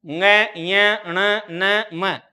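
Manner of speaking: ng, य, ण, न, म, each sounded as a separate nasal; five nasal sounds. Shown as a demonstration. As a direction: recite ng, य as ñ, ण, न, म one by one. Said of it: "पांच वर्ग का पंचम वर्ण".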